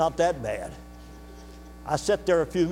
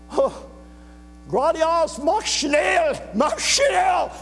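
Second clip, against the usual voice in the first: high, loud German command, acted out; urgent shout; 12 semitones higher, 3 dB louder, much slower than usual. Border guard urges traveller away, in an urgent shout. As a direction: shout it like a guard hurrying someone off.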